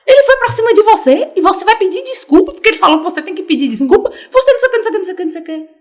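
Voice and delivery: loud, high, indignant sister's rant; furious sister. Retold or imitated. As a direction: tell him off, outraged on his behalf.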